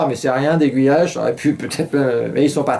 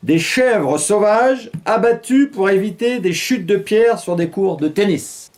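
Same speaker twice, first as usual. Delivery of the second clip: slow, higher newsreader delivery; news read; 7 semitones higher, slower than usual. Acted. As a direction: announce it like a TV anchor, slowly. Reads a news brief.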